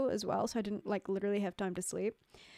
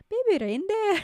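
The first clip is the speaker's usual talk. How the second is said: loud, high, butchered Scottish accent; hammed-up Scottish; 10 semitones higher, 8 dB louder than usual. Shown as a demonstration. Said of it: "I don't wanna do a Scottish accent".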